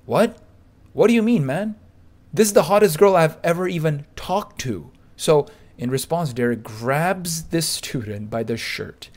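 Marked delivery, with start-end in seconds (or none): 0.1-4.9 surprised. Retold or imitated. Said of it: "Rich is like".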